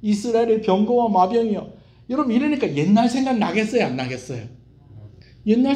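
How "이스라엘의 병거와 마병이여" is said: higher, louder weeping lament; a mock sobbing plea; tearful cry. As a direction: cry out as the king in tears. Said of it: "눈물을 툭툭 흘리면서".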